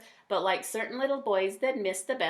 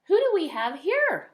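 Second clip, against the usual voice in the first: playful mom; 10 semitones higher, 8 dB louder than usual. Acted. Mom greeting playful son.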